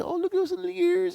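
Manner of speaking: put-on very high, quick baby-talk coo; cooing dog petter. Someone petting the husky's ears, cooing. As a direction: gush over a pet in a high voice. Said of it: "and you say".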